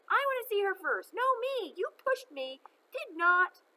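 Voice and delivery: put-on little kids fighting over who goes first; squabbling kids. A narrator's reading. Children squabbling to see her.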